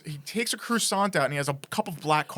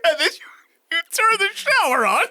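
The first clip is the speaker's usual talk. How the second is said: laughing through the words; giggling retelling of the ordeal; 7 semitones higher than usual. Real, not acted. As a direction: voice high and laughing, telling it.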